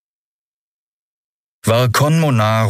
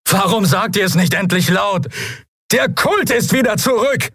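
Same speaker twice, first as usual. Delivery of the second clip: very high, strained outcry; anguished shout. A narrator's reading. Bearded man demands the truth from captain, desperate shout. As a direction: cry out the forbidden truth.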